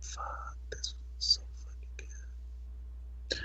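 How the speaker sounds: whispered mock pleasure. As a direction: hiss it under breath. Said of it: "I'm like".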